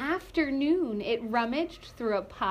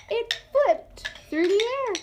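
usual speaking voice

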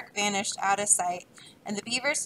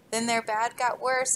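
usual speaking voice

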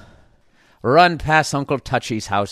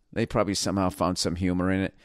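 normal talking voice

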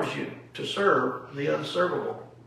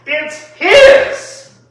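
"His" shouted, much higher and louder; stress on His; 11 semitones higher, 14 dB louder than usual. Real, not acted. Teacher correcting whose obedience counts, shouted stress on "His". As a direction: hit "His" with a sudden high shout. Climax of contrast with your obedience.

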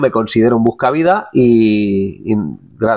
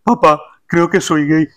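slow, higher, timid confession to his father; a son hesitantly confessing to dad; young prince Felipe; 4 semitones higher than usual. Acted. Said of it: "un chiste de los reyes".

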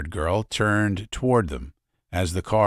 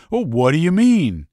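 sharp, raised demanding question; 10 semitones higher, 7 dB louder than usual. Acted. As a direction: push him for an answer, louder. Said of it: "the tub of a man demanded".

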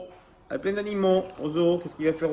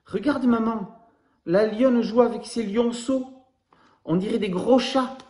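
higher, slow, wide-eyed wonder; marveling child; 8 semitones higher, 4 dB louder than usual. Acted. Baby koala shows mum the lions, in a high slow wondering voice. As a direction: point out the lions in awe.

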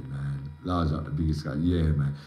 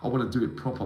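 ordinary delivery

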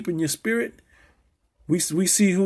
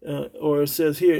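usual speaking voice

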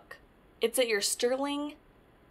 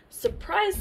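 high, eager "Surprise"; spring it on them gleefully; little duck showing off a surprise; excited surprise; 10 semitones higher, 3 dB louder than usual. Acted.

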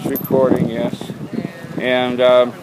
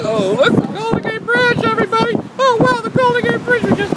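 excited; faster than usual. Real, not acted. First sight of the bridge.

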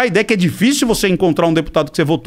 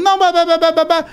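higher mock babble drowning him out; mock blabbering; 8 semitones higher than usual. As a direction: parody him talking over everything, pitched up. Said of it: "Cara, vamos falar de São Paulo". (imitando o outro tagarelando, voz mais aguda)

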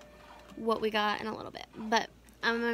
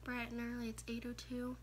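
hushed, groggy morning voice; sleepy morning whisper; 16 dB quieter than usual. Real, not acted. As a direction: whisper it like you just woke up. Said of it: "I still got morning voice".

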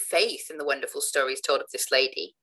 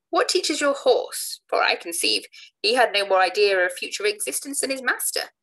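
put-on bursting-out young Whiffle; 6 semitones higher, 4 dB louder than usual. Whiffle can no longer hold back from the orator, higher and pointed. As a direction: let the jab fly, no longer held back.

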